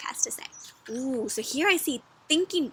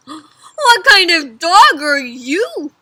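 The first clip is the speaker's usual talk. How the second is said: high, loud, very drawn-out disbelief; shocked; 5 semitones higher, 14 dB louder, much slower than usual. A narrator's reading. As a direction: drag out the question, astounded. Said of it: "They're so shocked, right?".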